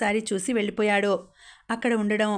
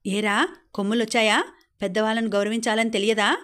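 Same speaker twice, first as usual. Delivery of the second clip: ordinary delivery, nothing marked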